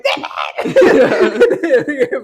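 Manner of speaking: loud shouted curse. Retold or imitated. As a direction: yell it while laughing. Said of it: "my brother behind me going".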